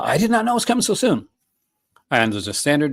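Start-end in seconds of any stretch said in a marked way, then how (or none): 0.0-1.3 much higher, delighted disbelief on not; excited surprise. Real, not acted. Vlogger thrilled by news of the early release, excited. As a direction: squeal out the surprise.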